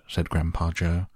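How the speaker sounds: ordinary delivery, nothing marked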